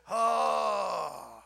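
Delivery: loud, long drawn-out groan; complaining groan. Acted. Preacher showing how he complains, long loud groan. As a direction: heave out a hammed-up moan.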